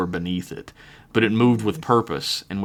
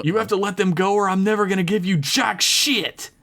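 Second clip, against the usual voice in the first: defiant shout; 10 semitones higher than usual. Acted. T K defiant to villain.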